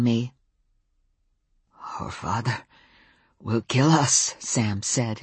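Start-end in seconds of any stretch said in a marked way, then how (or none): 2.0-4.3 low, halting Welsh-tinged wolf; slow, thick Welsh intonation. A narrator's reading. Wolf warning about his father, slow Welsh intonation. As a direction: speak slowly with a heavy Welsh lilt. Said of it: "his voice slow and thick with Welsh intonation".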